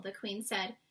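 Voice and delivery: usual speaking voice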